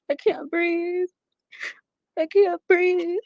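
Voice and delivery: high, strained panic reliving choking; panicked. Retold or imitated. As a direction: gasp it out as if choking. Reliving being strangled, panicked.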